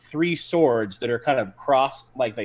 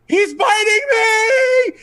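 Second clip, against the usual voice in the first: very high, loud, drawn-out victim's scream; 10 dB louder, much slower than usual. Acted. Bitten man crying out into the smoke, screaming. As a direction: shriek it slowly for the whole battlefield to hear. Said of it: "he screams out into the smoke".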